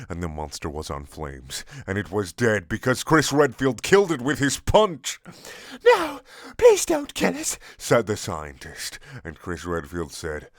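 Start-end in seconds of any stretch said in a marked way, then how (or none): 5.8-7.6 much higher, squeaky hammed-up plea; begging for life. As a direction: beg like a panicked cartoon scientist. Scientist begs for life.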